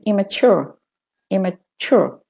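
"immature" with American r ending, falling. Shown as a demonstration. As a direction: sound the final American r in "immature". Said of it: "with the American".

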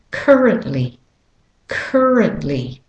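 currently with an American "er" in CUR; American pronunciation, R-coloured first syllable of currently; American accent. Shown as a demonstration. Teacher modelling for learners, American accent on currently. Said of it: "if you're saying it with an American accent".

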